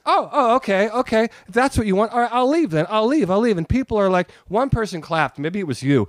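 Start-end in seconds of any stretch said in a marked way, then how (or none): 0.1-3.6 high-pitched, wounded retort; mock a rattled performer giving in huffily; higher, flustered retold surrender; imitating flustered comic. Heckled comedian flustered, offers to leave.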